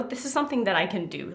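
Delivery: normal talking voice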